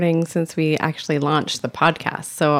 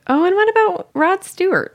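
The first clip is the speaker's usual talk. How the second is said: suddenly remembering; 4 dB louder than usual. Real, not acted.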